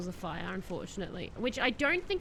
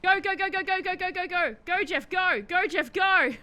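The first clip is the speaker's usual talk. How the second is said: high, loud chant of go; urgent cheering; 7 semitones higher, 5 dB louder than usual. Real, not acted. Streamer cheering his character on, urgent. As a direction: urge your character on.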